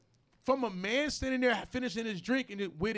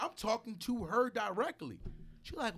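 ordinary talk

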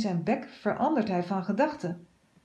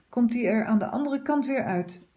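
plain reading voice

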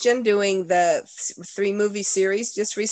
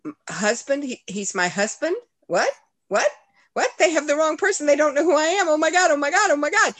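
shocked disbelief; 10 semitones higher, 4 dB louder than usual. Retold or imitated. Retold shock at meeting her husband.

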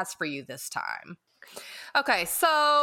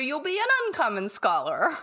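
normal talking voice

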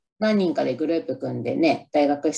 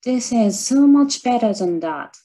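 slow, louder, savoring better than that; movie line imitation; 6 semitones higher, 4 dB louder, slower than usual. Shown as a demonstration. Vlogger nails the favorite movie line, slow and emphatic. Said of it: "ここがすごく言いたかったんだよな".

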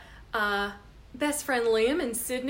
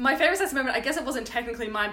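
ordinary talk, nothing marked

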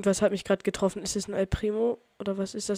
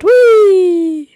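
high, loud joyful cry; playful rollercoaster squeal; gleeful whee; 10 semitones higher, 15 dB louder than usual. Real, not acted. Launched by jump pad.

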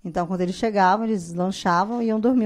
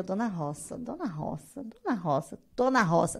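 soft repeated muttering of the name; softly rehearsing the name; repeating to memorize; 6 dB quieter, slower than usual. Retold or imitated. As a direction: say it over to learn it. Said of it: "eu falo até eu gravar".

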